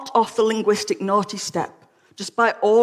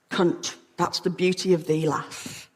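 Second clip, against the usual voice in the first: softer, lower, trailing to a whisper; hushed tenderness; 3 semitones lower, 5 dB quieter than usual. Acted. Mellors tells his lover what it means, hushed and tender. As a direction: let the last word fade into breath. Repeats Mellors' line more softly.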